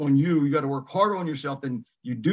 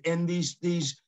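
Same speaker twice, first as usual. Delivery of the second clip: ordinary talk, nothing marked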